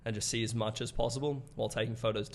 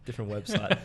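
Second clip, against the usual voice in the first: laughing; 4 semitones higher, 8 dB louder than usual. Real, not acted. Joking about a porn channel.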